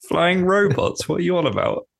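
high, baffled disbelief with a laugh; incredulous. Real, not acted. Co-host challenging talk of giant flying robots, incredulous. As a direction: stretch it out in amused disbelief. Baffled by friend's wrong description.

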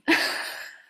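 a quick breathy burst of laughter; short laugh. Real, not acted. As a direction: laugh it off lightly. Laughing at extreme change.

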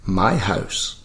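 lightly voiced old man; a hint of an aged prank caller; old guy. Old-man prank caller answering the taxi operator, lightly aged voice. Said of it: "pretend to be, like, an old guy".

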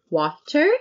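"Water?" as an over-articulated question; bad actor's improvised "Water?"; odd "Water?". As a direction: ask "Water?" with a rising, stilted delivery. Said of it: "we are forever saying".

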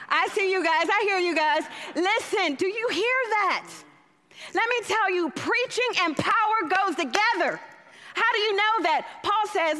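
rising, fervent preacher's call; building sermon fervour; preaching at full force. Real, not acted. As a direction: preach it out to the whole room.

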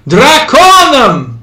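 high, loud, slow cry; shouting. A narrator's reading. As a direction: yell it over the mob. Shouting fake Latin at crowd.

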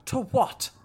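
high, soft baffled question. Acted.

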